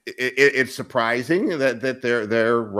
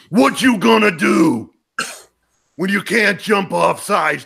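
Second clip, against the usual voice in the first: Hulk Hogan; 5 semitones higher than usual. Retold or imitated. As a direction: yell it like a pumped-up wrestling promo. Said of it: "this is what he would say".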